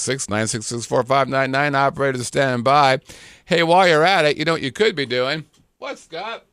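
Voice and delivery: hyped-up radio announcer, high and loud. Acted. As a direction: sell it like a late-night ad. Announcer-style plug, mock dialogue.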